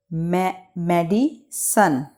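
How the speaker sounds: Medicine slowly in parts, with a restart; me, then medi, pause, sun for medicine; spaced-out beats on medicine; broken into syllables. Shown as a demonstration. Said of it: "इसको pronunciate कर लेते हैं".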